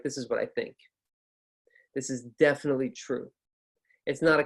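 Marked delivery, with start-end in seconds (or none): 0.0-3.3 "This is definitely true" said as a statement, going down; falling intonation. Shown as a demonstration. Teacher showing that down means confident, falling intonation. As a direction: let "think" and "true" drop firmly at the end. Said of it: "Down is more like".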